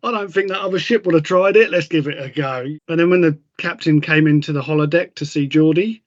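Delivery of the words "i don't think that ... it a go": mock Picard. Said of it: "and he was like".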